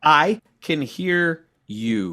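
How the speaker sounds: "I can hear you" stretched out very slowly, word by word; slow, deliberate. Real, not acted. Cohost proving he hears, "I can hear you" said very slowly. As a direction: spell out "I can hear you" with long drawn words.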